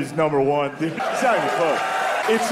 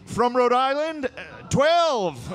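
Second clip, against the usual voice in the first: much higher, slow, reluctant delegate; a nervous lawmaker naming an age; high, slow, mock sheepish delegate; hesitant congressman; 11 semitones higher, much slower than usual. Voicing a Congressman.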